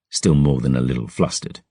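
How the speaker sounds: plain reading voice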